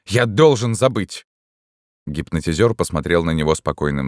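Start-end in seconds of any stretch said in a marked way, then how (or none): 0.1-1.3 higher, slow, agitated resolve; insistent. A narrator's reading. Distraught patient pleads with hypnotist, insistent. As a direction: repeat it as a desperate need. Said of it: "повторял он".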